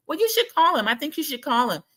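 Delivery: high, urging quote voice; imitated Larsa. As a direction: urge it high, imitating her advice. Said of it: "she says".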